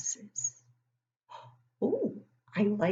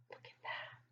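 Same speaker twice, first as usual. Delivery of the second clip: whisper; 20 dB quieter than usual. Acted. Teacher whispering to the children.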